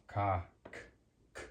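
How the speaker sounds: "ka", then a bare "k" release; hard k sound. Shown as a demonstration. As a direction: isolate the "k" after "ka". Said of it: "sounds like".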